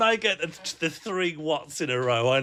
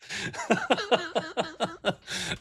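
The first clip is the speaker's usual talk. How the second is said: high-pitched burst of giggling; can't hold back a squeaky laugh; giggling fit at a chat message; high giggle; 11 semitones higher than usual. Real, not acted. Reading chat, cracks up.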